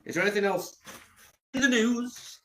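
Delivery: a silly high-pitched host voice; high mock announcer. Real, not acted. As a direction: rise way up high to ask.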